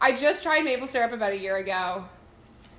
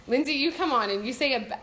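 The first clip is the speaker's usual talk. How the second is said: ordinary talk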